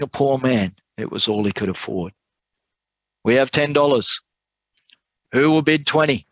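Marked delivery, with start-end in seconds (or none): 3.2-6.3 high-pitched auctioneer's call. A narrator's reading. Auctioneer to the crowd after a first bid, calling out high.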